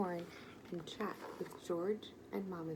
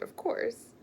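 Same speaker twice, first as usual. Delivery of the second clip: usual speaking voice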